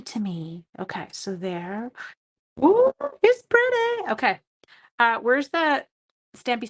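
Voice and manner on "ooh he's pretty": much higher, louder, slow delighted ooh; delighted coo. Real, not acted. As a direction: swoon over how pretty it came out. Admiring her stamped design.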